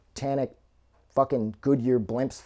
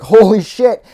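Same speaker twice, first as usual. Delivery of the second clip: retold shocked yell, louder and higher; panicking; 9 semitones higher, 14 dB louder than usual. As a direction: blurt it out in sudden panic. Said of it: "she panics".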